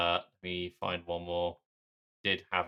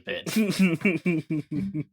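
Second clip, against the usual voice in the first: slow, higher words through laughter; laughing; 10 semitones higher, 5 dB louder than usual. Real, not acted. Co-host laughing while protesting he heard it. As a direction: laugh out the denial.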